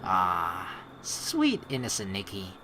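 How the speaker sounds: ordinary talk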